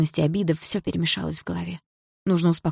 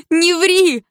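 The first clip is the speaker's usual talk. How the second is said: much higher and louder, laughing in fury; angry laughing cry; 13 semitones higher, 9 dB louder than usual. A narrator's reading. Polina calls Dima a liar, furious laughing shout. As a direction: hurl it through bitter laughter. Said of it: "рассмеялась я звонко".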